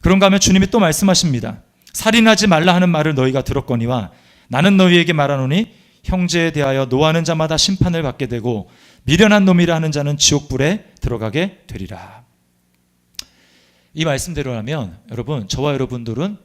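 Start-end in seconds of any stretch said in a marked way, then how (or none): 1.9-12.2 louder, solemn reading of the verse; scripture recitation. Acted. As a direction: proclaim the words of the Lord. Quotes scripture aloud.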